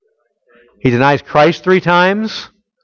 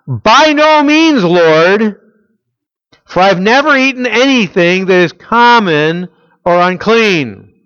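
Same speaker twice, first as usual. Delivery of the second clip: Peter refusing; 6 semitones higher, 4 dB louder, slower than usual. Retold or imitated.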